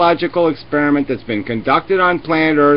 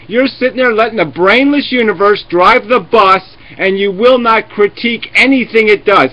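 usual speaking voice

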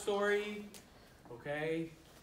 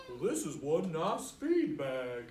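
slow, lower horse voice, pleased; 4 semitones lower than usual. Acted. Reader voicing the horse about its feed bag, slow and low. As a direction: drawl it like a contented old horse. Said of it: "And our horse says".

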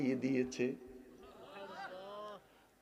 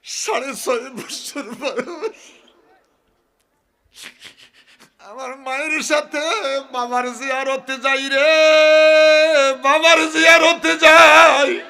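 high sobbing lament swelling to a loud wail; tearful wailing; 13 semitones higher, 19 dB louder than usual. Acted. Prophet weeping over visiting his father's grave, wailing. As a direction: cry it out, gasping between phrases. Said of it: "আমার নবী কেঁদে বলে".

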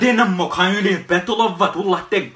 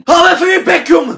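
high, loud shout; furious yell; 8 semitones higher, 6 dB louder than usual. Acted. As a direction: scream at him to shut up. Yelling shut up.

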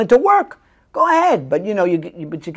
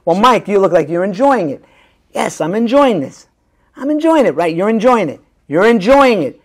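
heated repetition exercise; 5 semitones higher, 7 dB louder than usual. Shown as a demonstration.

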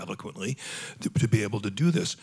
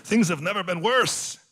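put-on high, kvetching Yiddish accent; 9 semitones higher than usual. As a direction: complain like an old Yiddish grumbler. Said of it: "they go".

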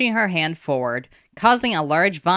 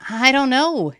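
higher, helpless reply; mild worried mumble; 9 semitones higher, 3 dB louder than usual. A narrator's reading. Boy unable to find Ren, worried reply. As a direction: admit it worriedly, not really mumbled. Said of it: "he mumbled".

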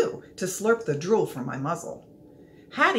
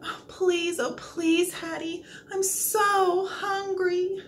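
whimpering plea; 9 semitones higher, slower than usual. A narrator's reading. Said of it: "I whimper and moan".

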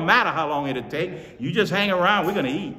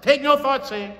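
much higher and louder, TAKE NO THOUGHT declared; emphatic decree; 10 semitones higher, 6 dB louder than usual. Shown as a demonstration. Preacher declaring the scripture to himself, high and loud. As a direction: proclaim it to yourself with force. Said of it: "I'm saying to myself".

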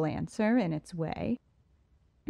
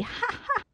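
triumphant high cackle. Acted. Riddler's delighted rant.

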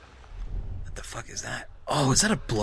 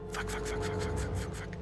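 rapid hushed string of swearing; whispered cursing; 10 dB quieter than usual. Real, not acted. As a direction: whisper it over and over in alarm. Spotted danger while sneaking.

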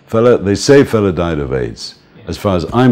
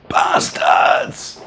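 unvoiced shouted curse out the window. Fela shouting at attackers on the bridge, harsh whispered yell. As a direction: hurl the insult at the attackers.

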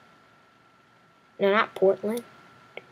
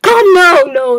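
dismayed shout; 7 semitones higher, 12 dB louder, slower than usual. Real, not acted. Losing the target.

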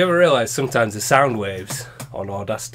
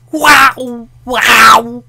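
high, loud imitation of a TIE fighter roar; shouted engine wail; 11 semitones higher, 11 dB louder than usual. Imitating the ship into the mic, loud wail. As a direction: howl it like a spaceship flying past. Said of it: "it'd have to go".

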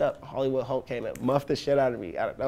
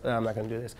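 softer, deflated giving up; mock depressed; 7 dB quieter, faster than usual. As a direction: drop the energy, say it dejectedly. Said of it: "then you get depressed".

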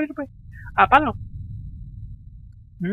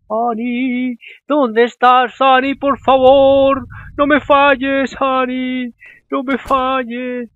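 pleading call, drawn out slowly; worried girl calling her missing friend; 3 semitones higher, 4 dB louder, much slower than usual. Acted. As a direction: beg her not to let you down. Voicing Sophie searching for Annie.

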